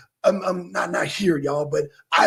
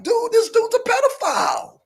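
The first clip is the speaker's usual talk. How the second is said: mock yell; 13 semitones higher than usual. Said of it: "nobody's like".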